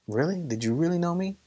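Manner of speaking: acted skeptical questioning. God answering a churchgoer's claim, skeptical questioning. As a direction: question the claim, pitch lifting at the end.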